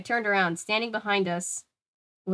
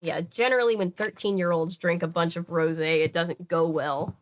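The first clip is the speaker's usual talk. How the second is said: ordinary delivery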